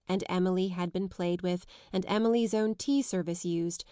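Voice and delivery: ordinary delivery, nothing marked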